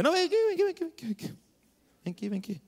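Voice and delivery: high, urgent calls of a street vendor, fading; merchant calling back. Retold or imitated. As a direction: call him back into the shop, pleading. Vendedor chama o cliente de volta. (vendedor chamando alto, depois sumindo)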